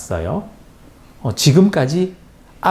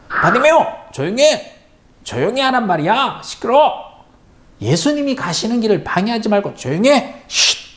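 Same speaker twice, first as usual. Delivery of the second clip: much higher, sharp scolding; scolding crowd; 10 semitones higher than usual. A narrator's reading. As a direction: tell the shouting blind man off. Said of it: "야단을 쳤어요".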